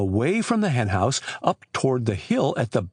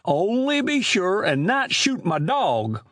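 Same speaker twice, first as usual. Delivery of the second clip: put-on high, slow rustic old man; Amos Grice; 7 semitones higher than usual. Old storekeeper telling boys of lost chickens, high drawling voice. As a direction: drawl it like an aggrieved country storekeeper.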